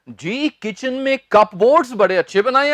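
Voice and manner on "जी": normal talking voice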